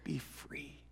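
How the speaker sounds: hushed, tender be free; whispered blessing. Acted. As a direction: breathe it out like a gift. Softly releasing the debt.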